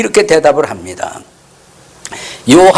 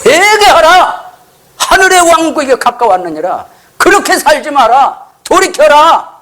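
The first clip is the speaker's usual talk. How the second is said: imitating a prophet's high, ringing proclamation; preacher voicing a bold, high-pitched prophetic cry; 9 semitones higher than usual. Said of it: "아주 담대하게 외쳤던 것입니다".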